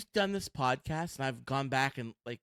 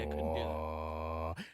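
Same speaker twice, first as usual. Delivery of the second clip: much lower, slow copy of that voice; deep put-on voice; 12 semitones lower, 4 dB quieter, slower than usual. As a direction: drop the voice way down. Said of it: "See what you did there?".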